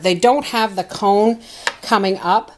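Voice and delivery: ordinary delivery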